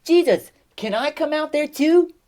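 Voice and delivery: high, eager question; excited. Acted. Peter asking to walk on water, excited asking. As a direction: ask eagerly, pitch jumping up. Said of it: "Peter gets really excited and says".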